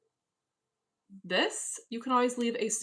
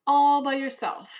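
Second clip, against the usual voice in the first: higher and louder, teasing echo; loud mocking echo; 6 semitones higher, 10 dB louder than usual. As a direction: throw the phrase back at him mockingly. Repeats the phrase louder.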